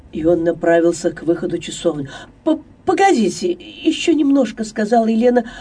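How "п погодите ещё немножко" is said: higher, hurried, stumbling on the first word; stammering, hasty "wait"; urgent stammered plea. A narrator's reading. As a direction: blurt it out to hold someone back. Elena stops him leaving, stammering.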